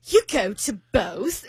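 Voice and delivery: slow, mock-British echo question; British accent. British date puzzled by two family holidays, slow imitated British accent. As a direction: imitate a puzzled Brit, drawn out. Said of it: "doing with the accent".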